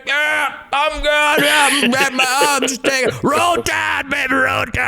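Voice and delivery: high strained shouts, pretending all is well; faking being fine. Gassed hiker shouting to friends ahead that he's fine, high strained cheer. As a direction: yell back cheerfully while totally gassed.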